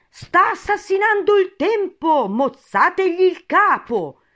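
very high, loud, drawn-out shriek; shrieking queen. A narrator's reading. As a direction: scream the order out slowly like an outraged queen. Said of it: "la regina si mise a strillare". (strillo acutissimo della regina)

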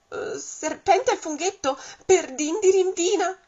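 a snake jolted by fright; startled snake. Acted. Serpente spaventato dal gruffalò. (serpente sbigottito, voce molto più acuta e forte)

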